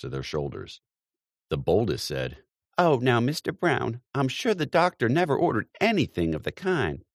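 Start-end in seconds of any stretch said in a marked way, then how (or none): 2.8-7.0 high, bright, teasing female voice; teasing young lady. A narrator's reading. Young lady teasing Mr Brown, high and playful. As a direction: playfully scold the old man.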